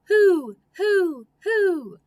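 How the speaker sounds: high, loud owl hoots for who. Pretend. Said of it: "think about what an owl says".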